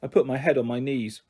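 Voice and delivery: usual speaking voice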